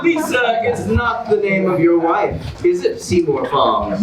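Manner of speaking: big, bright host announcement; game show host. Acted. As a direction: sell it like a TV host to the audience. Host reveals and eliminates.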